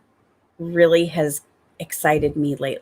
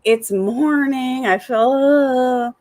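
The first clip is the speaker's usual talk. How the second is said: slow, drawn-out mock groan; morning dread; 4 semitones higher, much slower than usual. Mom waking up the other way, mock dread. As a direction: drag each word out, hammed-up reluctance. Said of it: "feel the other way".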